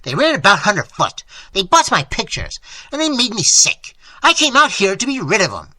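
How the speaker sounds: loud, worked-up complaint; panted rant. A narrator's reading. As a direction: carry on indignantly after the tantrum. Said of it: "he panted".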